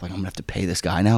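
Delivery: soft fast grumble; annoyed muttering. Retold or imitated. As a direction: grumble it under your breath. Annoyed inner thought.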